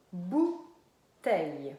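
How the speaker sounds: BOUTEILLE slowly split into BOU - TEILLE; two separate beats on BOUTEILLE, BOU, pause, TEILLE; syllable by syllable. Shown as a demonstration. Teacher naming what is in the basket, syllable by syllable. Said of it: "Donc, première syllabe".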